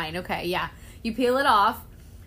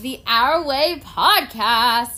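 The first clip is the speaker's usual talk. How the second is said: announcer voice; 7 dB louder, much slower than usual. Real, not acted. Announcing the show title.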